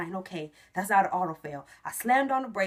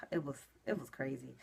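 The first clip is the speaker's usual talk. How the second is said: breathy whisper after a sigh; 5 semitones lower, 13 dB quieter than usual. Real, not acted. Recalling the test result, in a whisper. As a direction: hush it like a secret. Reliving the emotional moment.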